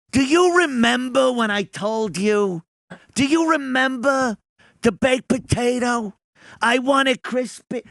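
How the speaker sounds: lightly voiced Dice, testy; Dice scolding waiter. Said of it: "Dice goes".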